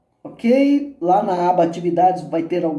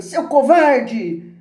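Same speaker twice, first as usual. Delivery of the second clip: high, drawn-out yelled insult; slow, high, falling holler; annoyed shout; 8 semitones higher, 4 dB louder, much slower than usual. A narrator's reading. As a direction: hurl it at the mountain, vexed. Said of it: "Contrariado, ele grita".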